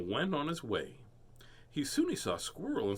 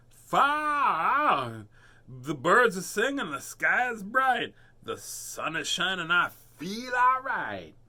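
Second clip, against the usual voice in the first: high, loud joyful turtle; loud high delighted turtle voice; happy turtle; 5 semitones higher, 5 dB louder, slower than usual. Acted.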